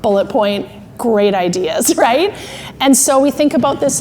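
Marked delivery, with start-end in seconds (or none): 0.0-1.9 low, slow, evenly spaced points; measured voice of reason; thinking brain. Acted. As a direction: tick items off calmly and deliberately. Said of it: "thinking brain's like".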